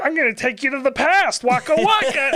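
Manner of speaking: high, silly Muppet impression; goofy Muppet voice. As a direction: ham it up like a Muppet. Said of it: "and then he's like".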